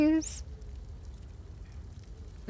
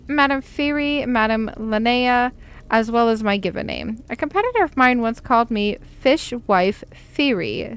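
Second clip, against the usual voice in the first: ordinary talk, nothing marked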